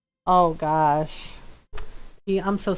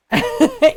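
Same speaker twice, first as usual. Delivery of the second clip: high burst of laughter; 7 dB louder than usual. Real, not acted.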